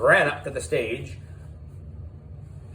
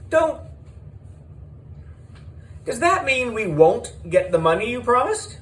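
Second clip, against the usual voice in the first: panicked; 12 semitones higher, 4 dB louder than usual. A narrator's reading. Mister Klutz panics.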